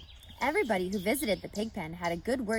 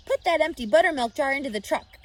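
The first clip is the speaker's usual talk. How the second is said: loud, higher order; commanding; 6 semitones higher, 8 dB louder than usual. A narrator's reading. Mr Arable loudly commanding Avery to load the jar. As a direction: bark the order at the boy. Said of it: "commanded Mister Arable".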